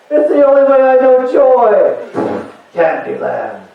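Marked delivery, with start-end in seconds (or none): 0.1-2.0 desperate junkie. Pretend. [name] answers friend.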